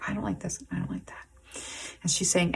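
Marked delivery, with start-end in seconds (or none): 0.1-1.3 hushed complaint. Retold or imitated. Person looking at their body, quietly dismissive whisper. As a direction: voice sinking to a whisper, a touch displeased. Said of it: "this person is like".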